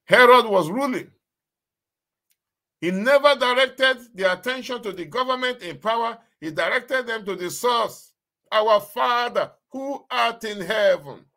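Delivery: high, loud proclamation; fervent preaching. Acted. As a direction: proclaim it to the whole church. Preaching at full force.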